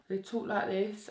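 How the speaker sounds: put-on London accent. Shown as a demonstration. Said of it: "a pretty good London accent".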